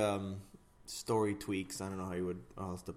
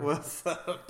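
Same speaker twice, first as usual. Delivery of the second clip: ordinary talk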